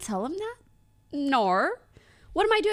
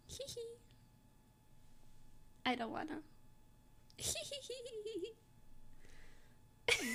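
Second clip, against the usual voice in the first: soft giggle; 8 semitones higher, 21 dB quieter, faster than usual. Real, not acted. Embarrassed giggles, nearly silent.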